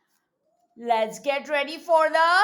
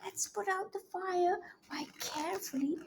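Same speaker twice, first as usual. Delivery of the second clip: hushed coaxing; 3 semitones higher, 11 dB quieter, faster than usual. Acted. Soft coaxing to turn page.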